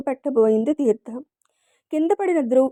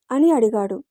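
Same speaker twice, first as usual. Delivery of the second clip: ordinary delivery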